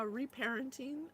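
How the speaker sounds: usual speaking voice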